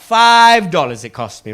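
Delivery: ordinary talk, nothing marked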